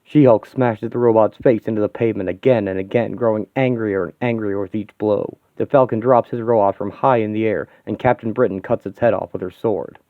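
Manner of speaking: ordinary talk, nothing marked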